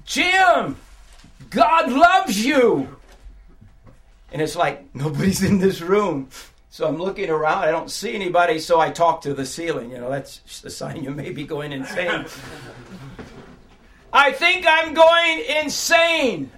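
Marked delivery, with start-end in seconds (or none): 0.1-3.0 high, loud, slow call of his name; voice crying out. Retold or imitated. A voice calls to the broken prisoner, loud and slow. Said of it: "it cried out to me". 14.1-16.5 high, loud, slow desperate yell; yelling to the ceiling. Retold or imitated. Prisoner yelling up to the ceiling, high and slow. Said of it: "I'm yelling to the ceiling".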